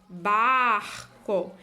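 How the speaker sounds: Barco with a long, stressed "bar", then "co"; syllable-final AR. Shown as a demonstration. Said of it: "veja que eu tenho o A R".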